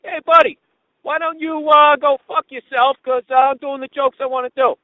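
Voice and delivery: high, loud mocking comeback; imitating the heckler. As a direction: throw the heckler's loud yell right back. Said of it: "you just imitate the guy".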